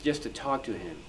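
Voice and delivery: ordinary talk, nothing marked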